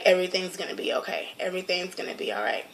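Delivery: quiet, fast comforting murmur; husband hushing his crying wife, quiet and reassuring; soft reassurance. Retold or imitated. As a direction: gently soothe her, softly and quickly. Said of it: "he was just like".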